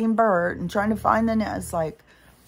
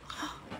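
1 shocked gasp; 15 dB quieter than usual. Real, not acted.

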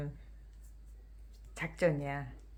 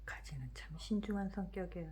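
soft unvoiced whisper; 13 dB quieter than usual. Real, not acted. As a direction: breathe it in a whisper. Whispered remark.

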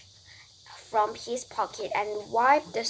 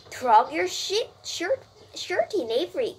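higher-pitched mild call; mother crying out; 6 semitones higher, 3 dB louder than usual. A narrator's reading. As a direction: call out to a child to tidy up. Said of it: "cried Missus Zuckerman".

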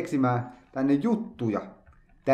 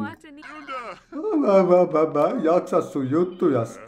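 annoyed character voice; 4 semitones higher than usual. Acted. Voicing annoyed game character.

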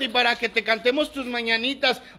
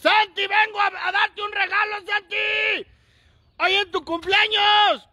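calling out; 8 semitones higher than usual. Real, not acted. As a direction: yell it so he hears inside. Calling out for the birthday boy. (llamando a gritos a Santi)

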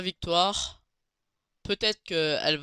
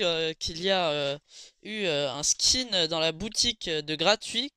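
usual speaking voice

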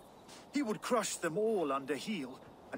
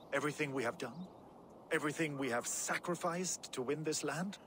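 normal talking voice

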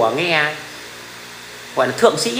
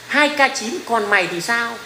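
high opening, mock challenge; kid's taunt, pitched up at the start; mimicking kid commenter; 4 semitones higher, slower than usual. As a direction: imitate a young troll calling someone out. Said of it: "Nó bảo".

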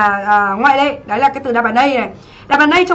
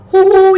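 high, loud playful "hu hu"; hammed-up boo-hoo; mock crying; 6 semitones higher, 6 dB louder than usual. Teacher asks struggling students to react, mock crying. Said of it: "cảm thấy khó thì thả".

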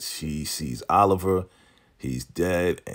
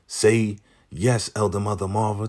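raised-pitch mock order; imitated command; 4 semitones higher than usual. Zane telling Lacey how to answer, imitated command. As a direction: prompt her firmly, pitch lifted.

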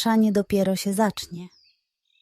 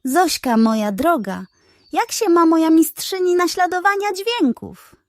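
flattering pug; 7 semitones higher than usual. Acted.

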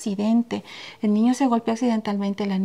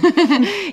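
high burst of laughter; 7 semitones higher, 5 dB louder than usual. Real, not acted. Laughing at own apology.

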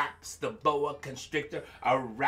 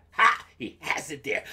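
ordinary delivery

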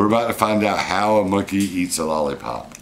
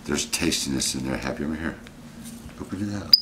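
softer, lower gentle coaxing; 5 semitones lower, 8 dB quieter than usual. Real, not acted. Owner softly coaxes Happy to open the lollipop. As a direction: ease the monkey into trying it.

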